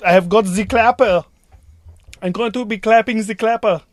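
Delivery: put-on loud, comic German accent; mock German accent. Crew member showing off as clapper operator, mock German accent. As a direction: announce it in a hammed-up German accent. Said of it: "can I do it in a German accent".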